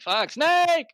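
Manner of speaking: high sudden yelled warning; shouting. Retold or imitated. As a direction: yell it like a panicked callout. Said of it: "you're like".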